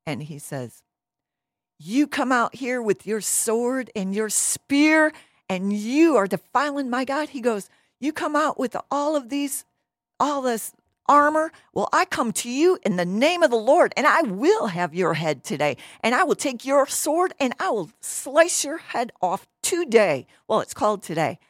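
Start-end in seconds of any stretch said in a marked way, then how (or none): 1.8-7.3 loud, high, emphatic declaration; David, so upset. Acted. Young challenger confronting the giant, upset and loudly defiant. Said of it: "David gets so upset". 8.0-20.3 loud, high, emphatic declaration; David, so upset. Acted. Young challenger confronting the giant, upset and loudly defiant. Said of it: "David gets so upset".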